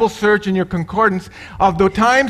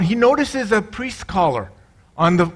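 ordinary talk, nothing marked

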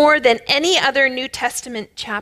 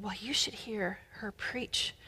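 whisper; 2 semitones lower, 17 dB quieter than usual. Retold or imitated. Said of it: "and the one said".

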